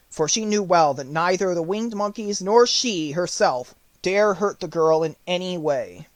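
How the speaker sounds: plain reading voice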